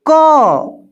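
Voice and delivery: "क" said loud and high with a full अ; "क" with its vowel clearly heard; clear demo sound. Shown as a demonstration. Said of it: "क बोलने पर अ की sound आ रही है".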